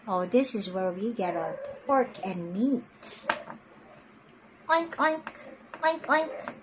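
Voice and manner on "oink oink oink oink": high playful oinking; pig oinks. Shown as a demonstration. Teacher showing kids the pig, high oinking. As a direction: oink like a pig.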